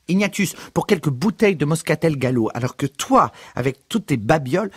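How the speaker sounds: mother laying down the law; mother scolding son about money; stern mother. A narrator's reading.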